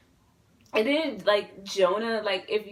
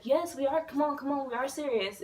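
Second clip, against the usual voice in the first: higher-pitched, lightly acted reassurance; eager insisting; 6 semitones higher than usual. Friends retold telling a girl they are serious, eager insistence. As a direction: replay eagerly convincing someone it's true.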